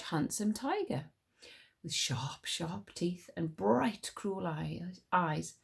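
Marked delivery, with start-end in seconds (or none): none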